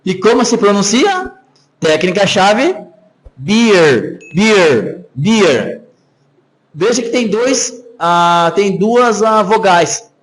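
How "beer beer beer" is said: "beer" with a long, stretched E; drawn-out E. Shown as a demonstration. Teacher shows students how to pronounce the word, drawn-out E. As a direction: stretch the vowel in "beer", falling at the end. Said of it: "tu espichou a letra E".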